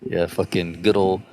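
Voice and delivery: ordinary talk, nothing marked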